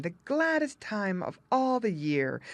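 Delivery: usual speaking voice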